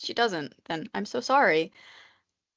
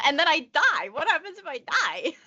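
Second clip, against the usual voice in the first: laughing; 6 semitones higher, 3 dB louder than usual. Real, not acted. Joking about dying in labor.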